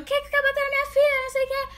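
high-pitched imitation of irate mom; frantic angry mother. Daughter retells mom confronting the bullies, frantic high voice. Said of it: "igual uma louca, correndo atrás dela, perguntando".

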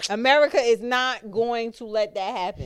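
loud, high acted protest; imitating an outraged objection. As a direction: declare it forcefully, quoting someone fed up. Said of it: "Smooth was like".

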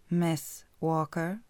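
smug man stretching out the words; slow drawled name. Acted. Cocky footballer slowly saying her name behind her.

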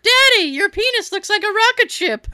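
put-on high, excited kid voice; child voice. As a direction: play the little kid, high and chirpy. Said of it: "I hear".

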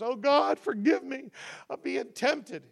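high, whiny mock crying; mock tearful plea. Mocking people who weep over temptation, high whiny plea. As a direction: ham up a weepy collapse. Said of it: "collapse into a puddle of tears".

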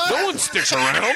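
high-pitched retort; mock outburst. Comic retells his comeback in an argument, mock outburst. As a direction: fire back in a high, exaggerated voice.

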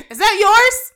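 high, loud mock call to a stranger. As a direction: call out loudly and brightly to the bum. Acting out addressing the bum.

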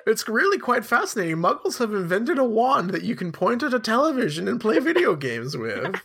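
put-on high, swooping old guy voice; kooky old Albus Dumbledore. Old wizard marvelling at a Muggle invention, kooky high old-man voice. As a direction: marvel like a dotty old wizard, pitched well up. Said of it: "an old guy voice for him".

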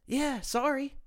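high, snide sorry; mock-sorry, pitched well up; sarcastic apology. Real, not acted. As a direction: toss off a fake apology. Sarcastic retort to critics.

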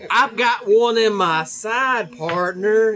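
slow cowboy drawl; Texas drawl. Retold or imitated. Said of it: "he said".

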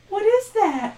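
put-on high girlish question; curious girl. A narrator's reading. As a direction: ask like a curious young girl. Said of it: "the older girl asks".

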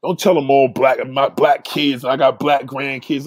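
ordinary talk, nothing marked

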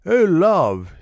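high, loud lovestruck cry; a high, falling "oh"; lord crying out. A narrator's reading. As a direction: call out to her, pleading. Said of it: "he cried".

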